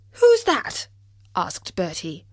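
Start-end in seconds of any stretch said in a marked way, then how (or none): 0.0-0.9 gasp, then high, loud question; alarmed, high-pitched challenge; startled. Acted. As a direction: jump at an unseen stranger. Bertie startled by unknown voice.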